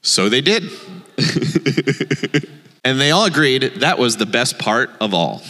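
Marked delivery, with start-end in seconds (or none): 0.8-2.4 rapid run of little chuckles. Real, not acted. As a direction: laugh lightly in quick pulses. Reader laughs after story line.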